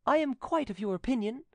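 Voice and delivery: high-pitched youth's voice; young nephew. Acted.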